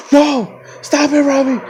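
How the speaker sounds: high, loud mock screaming; imitated scream. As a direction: scream it like a distraught passenger on the phone. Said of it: "she's just fucking screaming".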